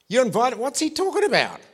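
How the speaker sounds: high, loud incredulous outcry; hammed-up protest shooting upward in pitch; grumbling crowd. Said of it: "the crowd begins to grumble".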